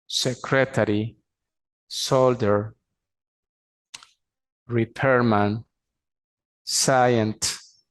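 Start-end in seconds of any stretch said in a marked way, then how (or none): none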